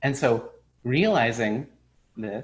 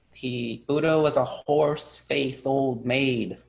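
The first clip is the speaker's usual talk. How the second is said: slow blunt verdict; slower than usual. Acted. Master's blunt slow reply.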